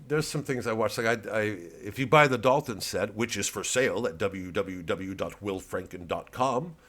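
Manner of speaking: usual speaking voice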